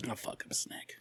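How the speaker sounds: barely audible, whispered; whispered aside. Real, not acted. Friend whispers a threat about snakes, whispered aside. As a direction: say it under your breath in a whisper. Muttered aside, listener says huh.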